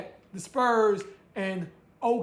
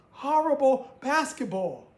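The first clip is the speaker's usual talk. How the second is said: usual speaking voice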